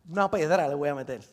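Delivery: low, soft matter-of-fact reply; deadpan repeat. Retold or imitated. David repeats his plan to the king, deadpan. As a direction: drop down and restate it calmly.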